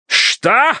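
very high, sharp roar; explosive high-pitched challenge; barked outburst. A narrator's reading. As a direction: bark it in outrage. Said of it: "рявкнул Джоу".

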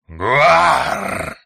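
loud raspy monster shriek; monster command cry. A narrator's reading. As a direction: screech it like a bird monster. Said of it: "скомандовал предводитель".

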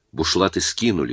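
ordinary delivery

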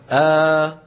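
آ held as a long open aa; drawn-out long a. Shown as a demonstration. Teacher demonstrating the long vowel to students, drawn out. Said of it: "فبدلا من أن يقولوا أ يقولون".

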